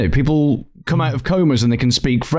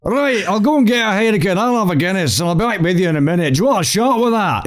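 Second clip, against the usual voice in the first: high, hammed-up Scottish accent; Billy Connolly; 7 semitones higher, faster than usual. Shown as a demonstration. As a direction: order a round in bad Scottish. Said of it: "It tends to go a really bad Billy Connolly".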